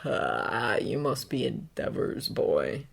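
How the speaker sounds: low, slick menacing voice; sinister villain voice. A narrator's reading. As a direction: purr it like a killer. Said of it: "Stain's voice is as slick as oil".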